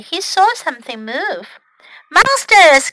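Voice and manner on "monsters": high, loud storybook cry; alarmed cry. Acted. Snail seeing something move, alarmed cry. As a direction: cry out at a sudden sight. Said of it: "cried Snail".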